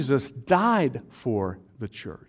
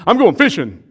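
loud, higher-pitched impatient announcement; impatient outburst; 6 semitones higher, 11 dB louder than usual. Acted. Impatient Peter to the disciples, loud outburst. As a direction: blurt it out, fed up with standing around.

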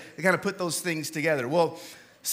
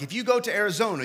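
usual speaking voice

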